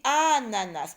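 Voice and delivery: ANANAS with a long opening A; stretched first syllable. Shown as a demonstration. Teacher points out the first syllable, ANANAS with A drawn out. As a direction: hold the first A of ANANAS, then finish. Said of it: "Première syllabe".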